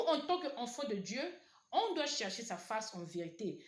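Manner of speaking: loud, high fervent preaching; preaching at full force. Acted. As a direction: cry it out to the church. Climax of sermon, shouted exhortation.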